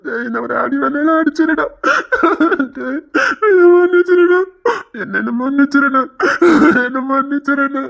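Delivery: sobbing plea for forgiveness; tearful begging. Acted. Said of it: "ஏன்டா அழுதுட்டு இருக்க".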